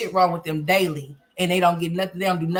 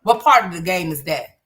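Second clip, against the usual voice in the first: usual speaking voice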